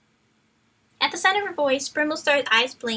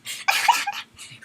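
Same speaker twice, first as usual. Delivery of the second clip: high breathy laugh. Real, not acted. Laughs mid-reading.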